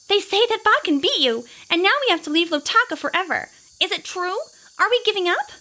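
put-on high, upset child voices; tearful little girls. Tearful girls asking Grace if they must give up. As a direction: plead like worried little girls. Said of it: "tears in their eyes".

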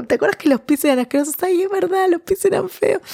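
high, laughing mock friend voices; giggly friend banter. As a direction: squeal it like giggling girlfriends. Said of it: "retold friends' banter".

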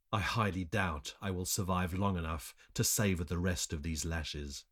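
ordinary delivery

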